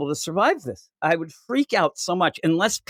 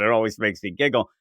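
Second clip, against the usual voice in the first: ordinary talk, nothing marked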